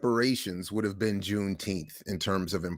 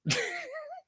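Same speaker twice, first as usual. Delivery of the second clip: high, soft chuckle; soft high laugh; 8 dB quieter than usual. Real, not acted. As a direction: chuckle to yourself. Laughs at own joke.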